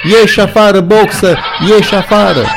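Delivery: high, loud frantic cry; shouting together. A narrator's reading. All the animals shouting to Boxer to get out. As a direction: shout it as a crowd of voices. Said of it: "preluară strigătul".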